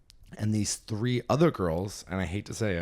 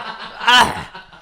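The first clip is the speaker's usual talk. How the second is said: high laughing gasp of disbelief; mock shocked squeal; 13 semitones higher, 10 dB louder than usual. Retold shocked reaction.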